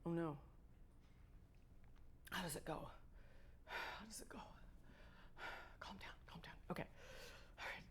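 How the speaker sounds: hushed panicked whisper to self; shakily calming oneself down. Real, not acted. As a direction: talk yourself down in a whisper. Panicked self-talk before playing.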